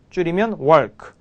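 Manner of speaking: usual speaking voice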